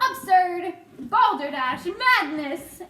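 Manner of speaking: loud scoffing outbursts; indignant shouts. A narrator's reading. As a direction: shout each word down. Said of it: "they shouted".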